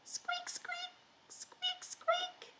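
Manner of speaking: tiny, high mouse squeaking; mouse squeaks. Pretend. Teacher gives children an animal clue, tiny mouse squeaks. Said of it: "what animal is this".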